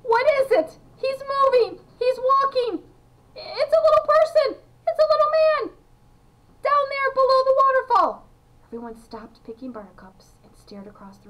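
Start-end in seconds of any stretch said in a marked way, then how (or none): 0.0-8.3 very high, loud shrieked exclamations; excited screaming. A narrator's reading. Veruca telling everyone about the little person, screaming with excitement. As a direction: keep shrieking the discovery at them. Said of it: "she screamed".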